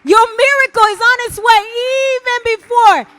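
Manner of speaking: high, loud preaching cry; ringing declaration, rushing at the end; shouted proclamation. Real, not acted. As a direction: proclaim it at full force to the congregation. Preacher proclaims at full force.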